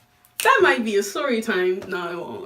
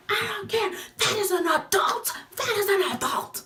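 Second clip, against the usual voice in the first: high, lightly voiced stern mom; mom's voice; 11 semitones higher than usual. Retelling mom dismissing her excuse, high and stern. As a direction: play the fed-up mother.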